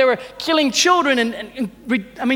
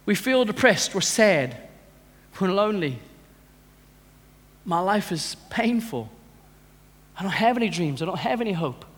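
soft, lower, hopeless voice; depressed; 5 semitones lower, 6 dB quieter than usual. Acted. Preacher voicing a depressed person to congregation, quiet hopeless sadness. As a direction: sink into acted despair, softly. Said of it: "that is the thing of depression".

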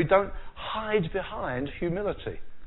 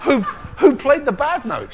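higher and louder teasing question; mock accusing; 9 semitones higher, 8 dB louder than usual. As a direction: tease the keyboard player, pitched up. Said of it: "you feel like saying".

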